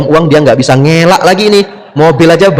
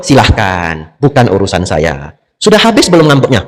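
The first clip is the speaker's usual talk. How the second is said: unbothered; 7 semitones lower than usual. Acted. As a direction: let them sulk, low and unhurried. Acting unbothered by someone's sulking. (santai tak peduli, nada rendah)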